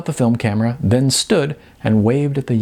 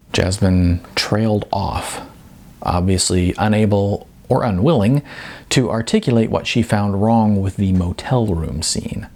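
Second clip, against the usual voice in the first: ordinary delivery, nothing marked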